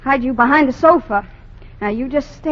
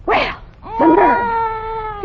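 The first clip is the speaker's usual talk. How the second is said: outraged; 9 semitones higher, much slower than usual. Acted. Outraged gasp at the threat.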